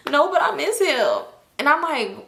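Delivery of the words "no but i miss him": slow, high imitated whine; drunken crying. Drunk friend missing her uncle, imitated tearful whine. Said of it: "Toxic start crying".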